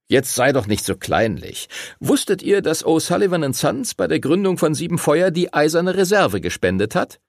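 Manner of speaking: a jolly teacher telling a story; Finlay's voice. A narrator's reading. Master Finlay cheerfully tells the boys about the bank.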